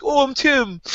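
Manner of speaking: high, sweet self-introduction; squeaky harmless act; mock innocent Tim. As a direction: play dumb and cute. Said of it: "He's like".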